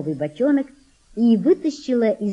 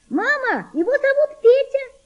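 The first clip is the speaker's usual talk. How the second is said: put-on girl shouting. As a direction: shout the news to mother across the boat. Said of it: "закричала девочка".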